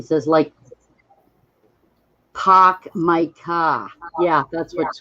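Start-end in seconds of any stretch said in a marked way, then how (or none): 2.3-3.9 put-on slow Massachusetts accent with dropped R's. Imitating Squirrel from Massachusetts for the chat, R-less Massachusetts accent. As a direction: imitate a Massachusetts accent, drawing out each word. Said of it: "No R's".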